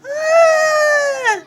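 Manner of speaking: high cry. Pretend. Goku cries out, high wailing ah. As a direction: wail like a hurt child.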